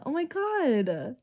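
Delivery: ordinary talk, nothing marked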